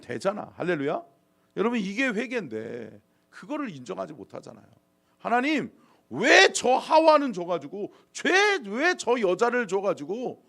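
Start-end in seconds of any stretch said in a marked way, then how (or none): 5.2-10.4 high, protesting complaint; aggrieved Adam accusing God; resentful blaming. Acted. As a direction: push the blame onto someone else, indignantly. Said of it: "하나님을 원망하잖아요".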